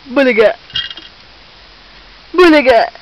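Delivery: put-on sudden loud burst to amuse a baby; playful boo. Mother surprising her baby in peekaboo, loud playful burst. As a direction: pop it out loudly in play.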